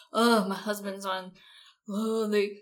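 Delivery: loud, exasperated whining wife; mock a spouse griping with big sighing "oh"s; complaining spouse. Host mimicking military spouses complaining about their husbands, exasperated complaint. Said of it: "spouses when they're complaining about their husband".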